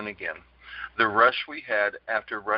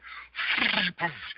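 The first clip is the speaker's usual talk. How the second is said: embarrassed laugh; 6 semitones higher, 3 dB quieter than usual. Real, not acted. Recalling projector crash mishap.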